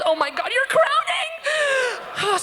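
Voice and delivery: high, stretched-out mock panic; hammed-up shocked outcry; alarmed shout. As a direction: shriek it in sudden alarm. Retold alarmed woman examining her.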